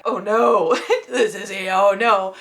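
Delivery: laughing "oh no"; mock dread through laughter. Real, not acted. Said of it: "and I was like".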